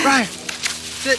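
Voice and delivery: loud, sharp command to sit; firm dog commands. Real, not acted.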